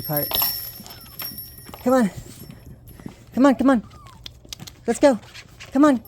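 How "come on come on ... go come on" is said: high, loud sing-song coaxing; coaxing pet voice. Real, not acted. Owner coaxing puppies outside, high sing-song. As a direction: lure the puppies out the door.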